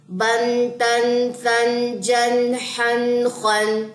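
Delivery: drawn-out syllables in a chant; very slow phonic reading. Shown as a demonstration. Teacher reads the phonics line to students, very slow chant. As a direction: stretch each syllable long and even. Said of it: "پھر اس کے بعد فونک".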